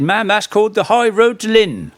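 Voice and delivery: put-on high, broad rural Norfolk voice for an old local man; a Norfolk countryman's accent; Norfolk yokel accent. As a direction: play the Norfolk villager answering the lady politely. Old Norfolk man answers lady.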